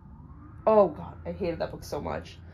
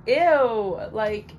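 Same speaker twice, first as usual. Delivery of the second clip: loud, high disgusted "Ew"; 3 semitones higher, 7 dB louder than usual. Real, not acted. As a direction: recoil at the very idea. Disgust at grumpy lead.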